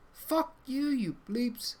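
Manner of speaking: high, loud mock outburst; angry cursing. Foul-mouthed character swears back at the player, high angry outburst. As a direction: spit it out high and indignant.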